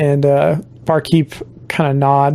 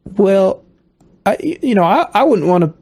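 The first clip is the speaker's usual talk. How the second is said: put-on Southern accent; 5 semitones higher than usual. Barkeep to player owed money, in a Southern accent. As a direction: lean into a drawling Southern barkeep. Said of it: "he's Southern now".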